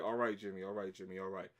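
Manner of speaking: low-pitched, calming repetition; placating boss. Retold or imitated. Boss tries to settle ranting worker, placating. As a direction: talk him down in a low voice.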